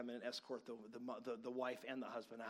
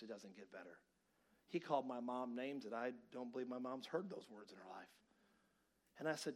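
ordinary delivery

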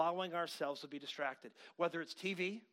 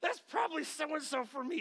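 put-on high-pitched excited teenager; high, hopeful exclamation; eager high schooler; 9 semitones higher than usual. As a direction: imitate a teen hoping the phone call is for them. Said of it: "us in high school, were like".

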